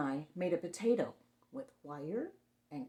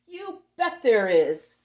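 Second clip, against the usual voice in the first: booming monster voice; 2 semitones higher, 14 dB louder, slower than usual. Acted.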